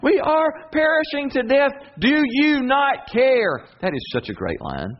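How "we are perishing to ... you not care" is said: much higher, louder, drawn-out plea; frightened disciples shouting at sleeping Jesus; desperate disciples. Retold or imitated. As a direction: beg Jesus to wake, voice straining high. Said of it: "That's literally what they say".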